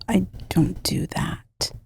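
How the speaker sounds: whispered, guilty aside; hushed, sheepish denial. Real, not acted. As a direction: deny it under your breath. Joking denial of hiding alcohol.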